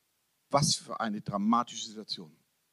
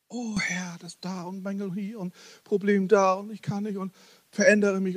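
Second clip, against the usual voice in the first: higher pleading prayer voice, hammed up; mock whiny prayer; 6 semitones higher than usual. Preacher mimicking a self-burdening believer, mock whiny plea. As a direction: whine a burdened prayer at God.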